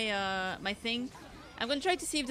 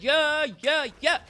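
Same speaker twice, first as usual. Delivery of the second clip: loud repeat; 5 semitones higher, 9 dB louder, slower than usual. Retold or imitated. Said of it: "I said".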